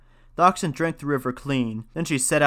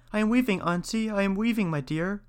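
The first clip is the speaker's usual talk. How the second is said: put-on girl's voice; 7 semitones higher than usual. Girl answers Baba Yaga.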